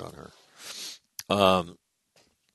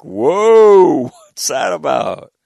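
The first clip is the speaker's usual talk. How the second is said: high, drawn-out mock whoa; startled reaction; 7 semitones higher, 12 dB louder, much slower than usual. Reacting to a bone crusher handshake, mock astonished. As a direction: imitate someone stunned by a crushing grip.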